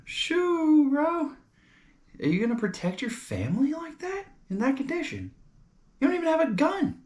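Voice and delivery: very high falsetto scolding; mock MSNBC dad. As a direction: squeal it like a caricatured dad. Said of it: "the M S N B C thing is like".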